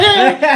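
sudden high loud laugh; loud high cackle; high burst of laughter. Real, not acted. Laughs at own comeback.

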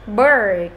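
"Bird" drawn out with curled tongue; model R pronunciation. Shown as a demonstration. Teacher modelling for the class, careful long "Bird". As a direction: round the lips slightly and hold the R in "Bird". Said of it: "cái miệng của chúng ta khom lại".

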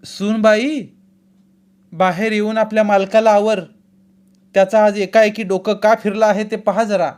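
high, loud call to the daughter-in-law; shouting. A narrator's reading. Mother-in-law calling out to the bride, loud and high. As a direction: bark the order across the house. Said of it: "सासू ओरडली".

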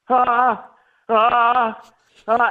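loud, high, drawn-out "ah"; blank-minded stalling wail, acted out; frozen panicked stammer sound. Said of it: "This is how I sounded".

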